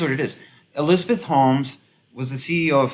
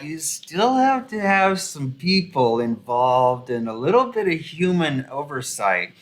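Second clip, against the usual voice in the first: higher, slow, drawn-out reluctant correction; mock hesitant tech expert; 4 semitones higher, slower than usual. Commentator imitating an industry guy correcting an elitist, drawn-out and hesitant. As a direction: let the elitist down gently, stretching the words. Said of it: "that guy was like".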